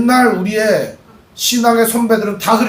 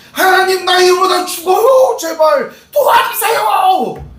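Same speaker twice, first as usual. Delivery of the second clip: much higher, slow, crying shout to God; desperate plea; 10 semitones higher, slower than usual. Acted. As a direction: beg for your life at the top of your voice. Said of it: "미치도록 하나님께 간구하는 거예요".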